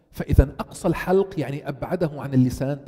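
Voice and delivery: ordinary talk, nothing marked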